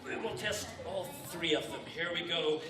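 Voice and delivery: usual speaking voice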